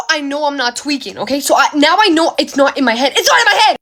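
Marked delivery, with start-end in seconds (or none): 3.2-3.8 high, rushed-out protest; frantic insistence. Retold or imitated. Storyteller insisting she didn't imagine it, frantic. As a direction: insist it in a raised, urgent pitch.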